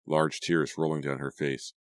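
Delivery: ordinary delivery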